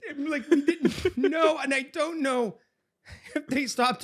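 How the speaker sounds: high, loud voice close to laughing tears; helpless laughter. Real, not acted. Storyteller agreeing they're cartoons, helplessly laughing. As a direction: gasp the words out through laughter.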